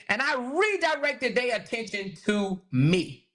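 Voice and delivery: ordinary talk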